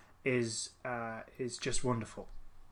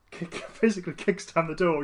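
normal talking voice

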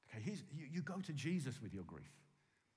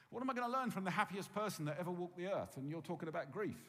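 ordinary talk

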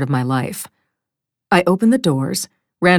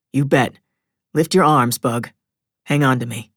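lower-pitched, steady fatherly voice; husband's voice; 4 semitones lower than usual. Acted. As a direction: play a calm dad lifting his child. Father answers and lifts his daughter.

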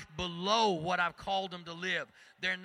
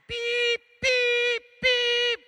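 high loud alarm beeps; imitated alarm beep; 12 dB louder, much slower than usual.

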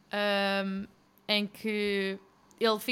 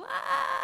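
movie-style cry; 13 semitones higher than usual. Retold or imitated. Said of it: "a correr à filme".

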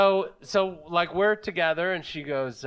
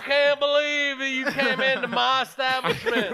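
Merle; 3 semitones higher than usual. Acted. Comic redoing his character for the hosts, indignant Southern hairdresser. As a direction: play an affronted Southern salon owner. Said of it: "Oh, that's Merle".

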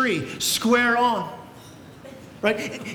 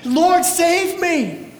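slow, high, loud desperate plea; crying out; 7 semitones higher, 4 dB louder, much slower than usual. Retold or imitated. Sinking Peter crying out to Jesus, slow desperate plea. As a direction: beg for rescue, drawn out. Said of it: "he cries out with this cry".

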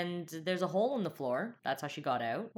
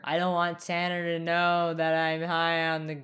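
slow, louder earnest cop voice; mock Toby confession; 4 dB louder, slower than usual. Teasing Toby's stoned confession to Spencer, in a slow solemn voice. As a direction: confess it gravely and slowly. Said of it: "when he's like".

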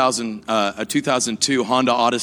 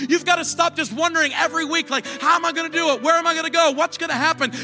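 higher, building sermon climax; preaching at full force; 10 semitones higher than usual. Acted. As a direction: preach it rising to the congregation.

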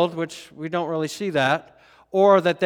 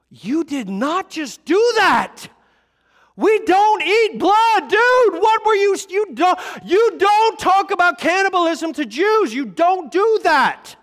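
high, loud, slow outraged yelling; outraged disbelief; 11 dB louder, slower than usual. Retold or imitated. Disciples scolding Jesus after the sermon, high outraged yell. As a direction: yell at him like he's lost his mind. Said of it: "They were like".

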